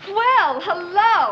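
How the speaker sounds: high, loud, swooping welcome; delighted greeting. Acted. Hostess greeting guests arriving on the terrace, delighted. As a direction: sing out a big pleased hello.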